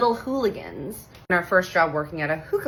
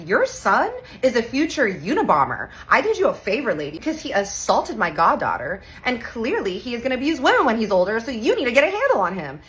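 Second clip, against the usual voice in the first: louder, higher, fast heated telling-off; heated rant; 6 semitones higher, 4 dB louder than usual. Retold or imitated. Aunt telling off the boy's mother in the principal's office, heated rant. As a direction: tear into the other mom.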